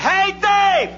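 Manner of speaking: high, long-held holler; distant bleacher shout. Acted. Fan yells from the bleachers, high distant shout. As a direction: call it out as if from far up in the stands. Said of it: "the voice again from way up in the bleachers".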